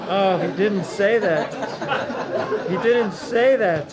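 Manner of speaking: chuckling, playfully incredulous; mock disbelief. Real, not acted. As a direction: feign shock at what he said.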